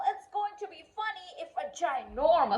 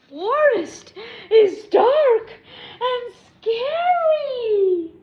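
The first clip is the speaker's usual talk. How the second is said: spooky dramatic; 5 semitones higher, 7 dB louder, slower than usual. Acted.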